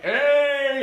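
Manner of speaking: loud high "Hey!" at a running deer; yell. Retold or imitated. Hunter yelling at a running deer, full shout. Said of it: "you're like".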